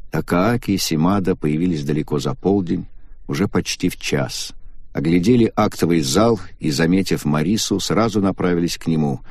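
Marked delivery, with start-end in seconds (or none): none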